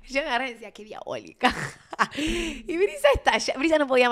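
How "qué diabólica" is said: low, half-murmured quote; low mock voice. Retelling the grabbing moment to viewers, low mock voice. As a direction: drop low for the imitation. Acts out a retold remark.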